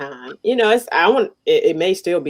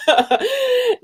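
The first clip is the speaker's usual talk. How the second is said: laughing; 10 semitones higher than usual. Real, not acted. Joke about her son, laughing.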